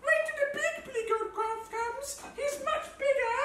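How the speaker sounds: high, thin little goat voice; very small voice. A narrator's reading. Youngest goat begs the troll, in a very small voice. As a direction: plead in a tiny squeak. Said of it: "in his very small voice".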